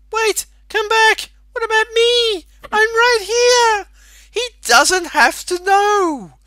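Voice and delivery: desperate pleading shout. Acted. Larry crying out to Faith as she leaves, high desperate wail. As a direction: yell after her like a jilted fool begging.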